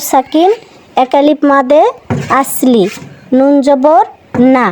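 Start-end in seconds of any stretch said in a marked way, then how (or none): none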